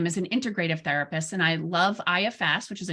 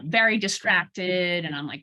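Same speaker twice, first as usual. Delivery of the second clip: ordinary talk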